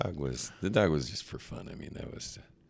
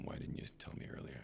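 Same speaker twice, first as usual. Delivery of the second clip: softly, almost under the breath; hushed question; 3 semitones lower, 13 dB quieter, faster than usual. Retold or imitated. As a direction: ask it quietly, half whispered. Retold question to brother.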